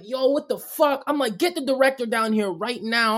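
high-pitched re-enacted demand; retold freak-out. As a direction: demand the boss, mock frantic. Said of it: "freaking out".

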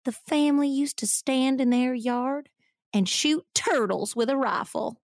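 prim Southern granny voice; bad Southern accent. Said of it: "bad Southern accent acting".

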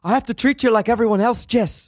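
high, fast imitation of a scolding coach; mock scolding. Imitating the coach scolding Jess, mock telling-off. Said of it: "scolds her in front of everybody".

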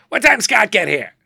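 high, loud raspy showbiz diva; brassy old comedienne calling out; Joan Rivers impression. Joan arriving early, asking for the photographer, brassy voice. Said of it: "I hear this unmistakable voice going".

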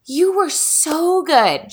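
high, drawn-out mock gushing; gushing praise. Mom praising her small child outside the store, imitated gushing. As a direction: imitate a mother lavishing slow praise. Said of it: "She praises me".

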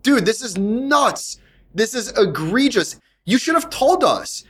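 high, drawn-out exasperation, each word stretched; indignant protest. Retold or imitated. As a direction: replay your outraged complaint to the manager, slowly. Retells arguing with manager over price.

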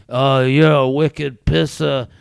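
a guy laying on a fake Boston accent to sound hot; sexy Boston accent. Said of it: "going for this sexy Boston thing".

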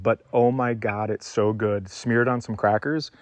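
ordinary delivery

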